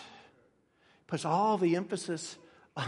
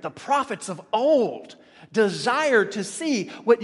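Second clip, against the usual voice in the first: loud, slow pulpit emphasis; forceful preaching; 5 semitones higher, 7 dB louder, slower than usual. Real, not acted. Preacher contrasting prophets of old with believers, forceful. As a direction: lean hard on the key words.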